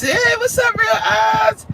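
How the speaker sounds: high, loud hey what's up; excited greeting. Real, not acted. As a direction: greet a viewer with a big shout. Greeting a viewer by name.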